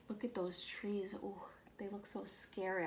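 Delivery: hushed mock-scared whisper; a soft, shivery aside to young listeners; spooky whisper. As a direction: whisper it like the woods might hear. Reader whispers about scary woods.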